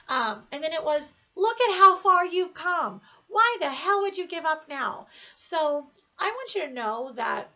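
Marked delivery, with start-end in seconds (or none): 1.3-5.1 loud, forceful self-motivation, pitched up at first; rally yourself with raised voice, stretching the opening words; insistent talking-to, loud and driven; emphatic self pep talk. Retold or imitated. Retold fierce pep talk to herself.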